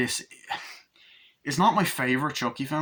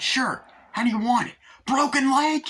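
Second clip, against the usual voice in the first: high, loud, gleeful threat; Chucky's high, loud taunt to the doctor; menacing Chucky; 14 semitones higher, 6 dB louder than usual. Retold or imitated. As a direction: taunt him before the blow.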